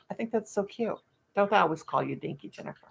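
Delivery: ordinary talk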